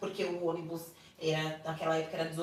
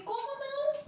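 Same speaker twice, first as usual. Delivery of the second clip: incredulous retold question. Retold argument with husband.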